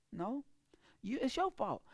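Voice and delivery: ordinary delivery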